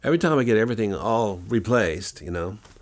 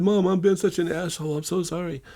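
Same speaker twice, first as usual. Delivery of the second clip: much higher, whiny mock-tearful voice; mock crying to mom; 9 semitones higher than usual. Bully crying on phone to his mom, high mock-whiny sobbing voice. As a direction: imitate a big man blubbering into the phone. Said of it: "he's crying on his phone to his mom".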